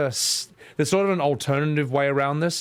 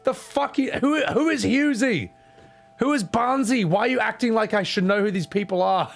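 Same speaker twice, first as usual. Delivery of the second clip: higher, baffled and indignant; higher, fed-up bewilderment at football talk; exasperated self; 10 semitones higher than usual. Retold or imitated. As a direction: play the confused outsider getting annoyed. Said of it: "It's like".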